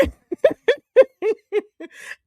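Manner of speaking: high-pitched burst of laughter; high giggling laugh. Real, not acted. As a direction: break into a loud giggle. Laughs at own story.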